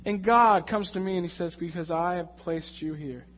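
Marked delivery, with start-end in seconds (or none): 1.5-3.3 calm voice of God. Acted. God answers calmly.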